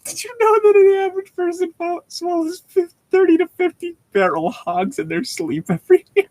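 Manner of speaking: a silly cartoonish falsetto quoting a meme; mock meme voice. Sudden meme quote, high voice.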